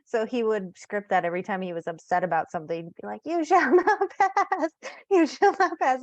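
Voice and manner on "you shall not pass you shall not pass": high, loud, slow wizard imitation with a laugh breaking in; wizard clock. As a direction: boom out a mock wizard's command. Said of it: "in this exact tone of this, like, wizard clock".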